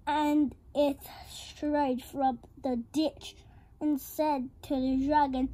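plain reading voice

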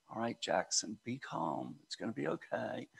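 put-on hushed, patient voice to soothe a fussy kid; soft calming dad. Tired dad quietly soothing his cranky son in the cart.

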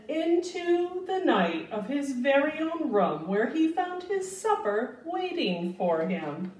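gentle, lilting storybook read-aloud; reading to children. A narrator's reading. Teacher reads Where the Wild Things Are to children, lilting storybook voice. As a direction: read it like bedtime story time for little ones.

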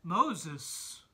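booming call of a name; God calling Moses from the burning bush; loud calling voice. Acted. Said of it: "said a loud voice".

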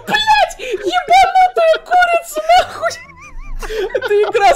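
very high laughing shout; hysterical laughing. Real, not acted. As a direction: crack up screaming. Chicken glitch, hysterical laughter. (истерический хохот)

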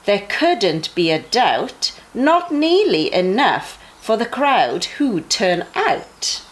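usual speaking voice

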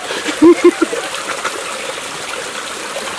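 airy delighted laugh; breathy laugh. Real, not acted. At the cleaned river, laughing breathily. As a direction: let out a breathy chuckle.

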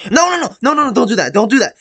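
high, loud no no no; panicked shouting. Real, not acted. Gamer yelling at the character not to do that, high and loud. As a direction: shout to stop it.

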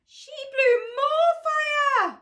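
high, slow wailing alarm; hammed-up dismay at the fire; mock alarm. Reader telling the child the fire grew, mock wail.

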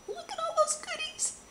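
high thrilled squeal over goodies; squealing delight. Real, not acted. Gushing over gift haul.

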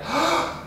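quick sharp recovery gasp; hook breath gasp. Shown as a demonstration. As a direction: gasp in and hold. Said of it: "recovery breath with a hook".

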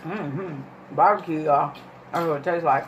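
crunching between words; talking while chewing. Real, not acted. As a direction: mouth partly full of chips. Seen 0: Left speaker bites chips before and during words, keeps chewing.

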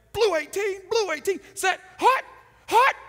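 high loud cadence; loud quarterback call; shouted snap count. Retold or imitated. Snap count third time.

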